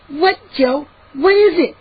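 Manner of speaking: high, concerned questioning; soft demand. A narrator's reading. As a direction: press a hurt friend anxiously. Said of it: "Mindy demanded softly".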